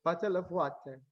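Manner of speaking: lightly imitated Google word playback; Google pronunciation. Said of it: "Google would pronounce it".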